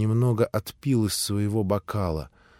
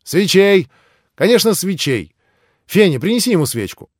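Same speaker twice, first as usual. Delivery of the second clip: excited call; 8 semitones higher, 7 dB louder than usual. A narrator's reading. Grushenka calls for candles.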